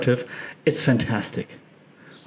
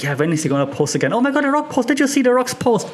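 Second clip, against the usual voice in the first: high, excited fan voice; eager fan; 10 semitones higher, faster than usual. Retold or imitated. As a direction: gush like a fan who can't wait. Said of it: "we're all eagerly turning the next page".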